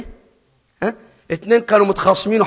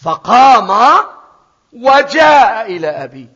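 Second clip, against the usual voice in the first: loud and slow, rising on فقام; triumphant proclamation; forceful announcement; 6 semitones higher, 8 dB louder, much slower than usual. Acted. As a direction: declare the rising up firmly. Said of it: "اسمع بقى".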